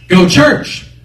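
high, loud order; insistent inner voice. Retold or imitated. Voice arguing with the driver, loud insistence. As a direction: overrule him forcefully. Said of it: "that voice came again and said".

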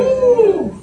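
high joyful whoop; a very high praise whoop; joyful woo. Real, not acted. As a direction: let out a celebratory woo. Preacher celebrates.

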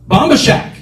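high, defiant comeback; arguing with himself. Retold or imitated. Driver answering the voice, arguing back. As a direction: fire back the rival choice. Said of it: "I had an argument with myself".

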